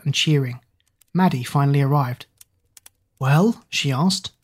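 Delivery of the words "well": plain reading voice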